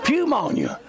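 "pneumonia" insisted on with a spoken P, pyoo-MOAN-ya; sounded P. Shown as a demonstration. Preacher insisting to congregation, "pneumonia" with the P pronounced. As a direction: hit the P at the start of "pneumonia". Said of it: "This is silent".

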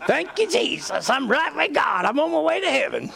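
high-pitched, put-on positive confession; mock piety. Backslidden believer insisting all is well, mock-pious and high.

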